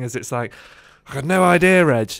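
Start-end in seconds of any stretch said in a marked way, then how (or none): 1.1-2.1 flustered exclamation. Retold or imitated. Overwhelmed trainee answering the trainer.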